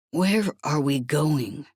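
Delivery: plain reading voice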